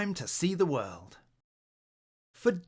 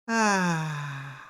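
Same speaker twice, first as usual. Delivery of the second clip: dreamy sigh; 3 semitones higher than usual. Acted. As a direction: breathe it out dreamily. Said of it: "breathed the blue owl dreamily".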